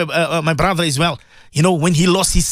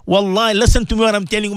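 ordinary delivery

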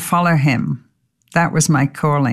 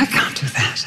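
soft breathy mock panic; whispered dread; 5 semitones lower, 5 dB quieter than usual. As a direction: whisper it, frozen by fear. Retold inner panic.

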